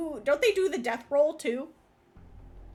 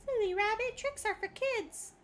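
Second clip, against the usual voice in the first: mock Trix ad voice; 7 semitones higher than usual. Quoting the Trix ad line.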